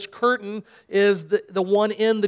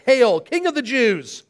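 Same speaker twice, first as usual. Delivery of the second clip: slow, higher, louder mock salute; 3 semitones higher, 3 dB louder than usual. Soldiers kneel and mock Jesus, slow mock salute. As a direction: hail him with contempt. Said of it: "they mocked him".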